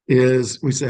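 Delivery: ordinary delivery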